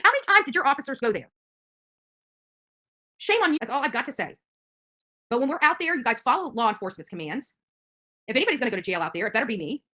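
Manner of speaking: ordinary talk, nothing marked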